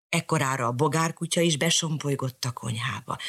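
usual speaking voice

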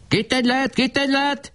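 very high screechy chant; screeching shout. A narrator's reading. Barabás jeering at Kolnai, screeching high. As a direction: screech it like a gloating boy. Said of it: "rikácsoló hangon kiabált".

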